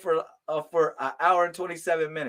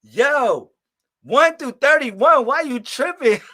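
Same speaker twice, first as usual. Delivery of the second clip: exasperated; 7 semitones higher, 7 dB louder, slower than usual. Retold or imitated. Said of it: "I be like".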